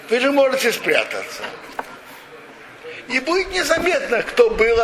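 ordinary delivery

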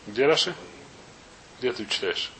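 ordinary talk, nothing marked

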